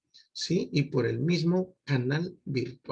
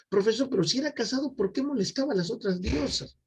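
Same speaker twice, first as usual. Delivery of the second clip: curious student; 8 semitones higher, faster than usual. Acted. Alumno imaginado pregunta al profesor.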